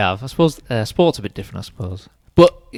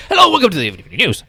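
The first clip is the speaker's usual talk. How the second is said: bright, fast newsreader voice, pitched up; newsreader impression; 7 semitones higher, faster than usual. Mocking the formal news voice to a podcast guest, fast and bright. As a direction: rattle it off like a TV news anchor. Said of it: "That was quite a good Teddy Morgan".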